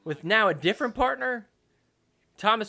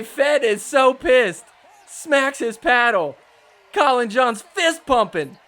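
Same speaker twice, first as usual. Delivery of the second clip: excited call; 5 semitones higher, 6 dB louder than usual. Real, not acted. Commentator calling a heated finish, excited and loud. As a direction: call the moment with energy. Heated point ends, player furious.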